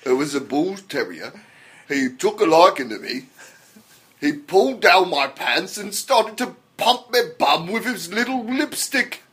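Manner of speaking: hammed-up British accent, rising as the story builds; terrible British accent. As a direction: confess it in an awful British accent. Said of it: "some of the worst accents ever".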